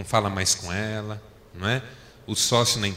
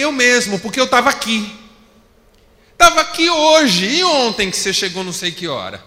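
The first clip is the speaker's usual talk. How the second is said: higher and louder, arguing back; raised voice in a quarrel; 10 semitones higher, 9 dB louder than usual. Retold or imitated. Pastor arguing loudly with his wife about coming home late. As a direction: fire back at your wife, voice climbing. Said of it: "Aí eu comecei a subir um pouco a voz".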